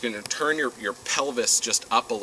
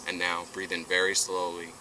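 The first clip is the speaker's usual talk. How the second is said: guided breathing; 6 dB quieter than usual. Acted.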